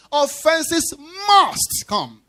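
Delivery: "must" slowed and leaned on hard; the whole line stretched out, "must" heaviest; stress on MUST. Shown as a demonstration. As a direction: weigh down on "must" slowly. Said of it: "So I'm stressing the word must".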